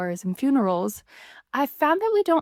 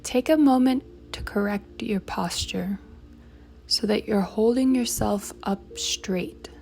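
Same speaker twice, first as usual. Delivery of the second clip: slow, measured meditation guide with long pauses; guided meditation; 3 dB quieter, slower than usual. Acted. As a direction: guide them through it unhurriedly, leaving room to breathe.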